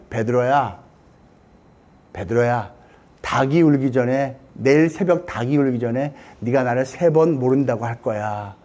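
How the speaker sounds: low voice of a knowing teacher; acted quietly, pitched low; gentle, grave foretelling. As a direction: address a disciple low and solemnly. Jesus gently foretells Peter's denial.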